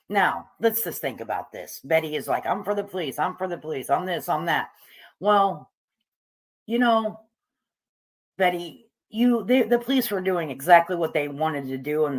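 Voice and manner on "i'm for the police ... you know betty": ordinary delivery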